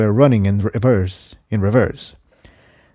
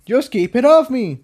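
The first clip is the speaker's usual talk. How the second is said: higher, mild shout; Jigsaw shouting; 13 semitones higher than usual. Acted. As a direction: yell over the fight. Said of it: "he shouted".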